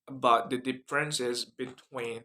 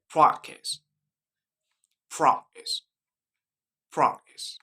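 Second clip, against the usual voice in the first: British "practice" said "prahk-tis"; British accent, broad open A in "practice"; 2 semitones higher, 4 dB louder, slower than usual. Shown as a demonstration. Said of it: "British accent".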